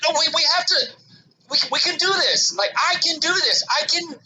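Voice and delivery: high, frantic self-pep talk; freaking out. Retold or imitated. Dad on the platform insisting he can navigate, freaking out. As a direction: talk yourself up in a high panicked voice. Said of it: "I was just freaking out".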